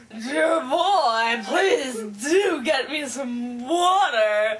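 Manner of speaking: put-on loud, slow, grand old-lady voice. Pretending to be his boss, old lady voice. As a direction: order it drawn out like an old lady. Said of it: "I went to my old lady mode".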